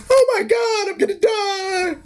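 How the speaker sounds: very high squealing mock panic; hammed-up falsetto wail; Thor as schoolgirl. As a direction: shriek like a frightened little girl. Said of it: "Thor was like a little schoolgirl".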